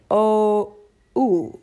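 O split into O and oo; O gliding to U. Shown as a demonstration. Guest showing the English diphthong, O then oo. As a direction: stretch O, then close to oo. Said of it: "a U at the end".

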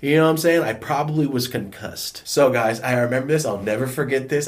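ordinary delivery

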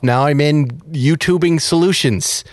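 usual speaking voice

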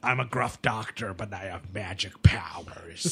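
low, slow gruff whisper; gruff Doctor House voice. Retold or imitated. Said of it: "doing Hugh Laurie doing an American accent".

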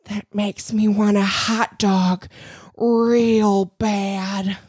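slow put-on film-character voice; Colette. Impressing co-host as Legally Blonde character, slow drawl. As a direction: draw it out like the Legally Blonde friend. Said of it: "I do a really good, um".

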